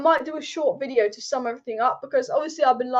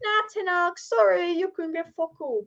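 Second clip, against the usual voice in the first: higher put-on voice, breezy fake apology; hammed-up higher voice of the board turning him down; mock dismissive club; 5 semitones higher than usual. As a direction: toss it off lightly in a higher mocking voice. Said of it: "They're probably like".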